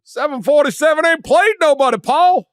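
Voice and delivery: high, loud mock caller rant; hammed-up call-in fan ranting; mock radio caller.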